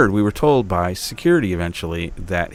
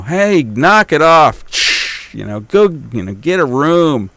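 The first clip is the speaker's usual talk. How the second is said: scolding shout; 5 semitones higher, 6 dB louder, slower than usual. Retold or imitated. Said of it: "Something like that".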